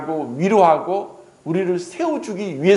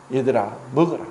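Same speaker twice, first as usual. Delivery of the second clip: gentle invitation; 6 semitones lower, faster than usual. Acted. Jesus invites them to eat.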